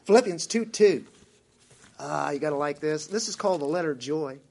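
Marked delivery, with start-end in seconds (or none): none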